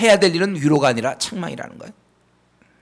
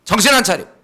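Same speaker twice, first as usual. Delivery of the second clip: loud, stern self-rebuke, higher and louder; scolding yourself; 5 semitones higher, 5 dB louder than usual. Shown as a demonstration. Preacher showing the church how to scold yourself, loud and stern. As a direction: shake yourself awake with a sharp scold. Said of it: "자기를 책망하세요".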